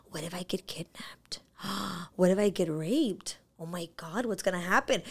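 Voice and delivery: frightened whisper. Retold or imitated. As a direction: breathe out the fear as a hushed, anxious whisper. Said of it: "my fear starts sipping in".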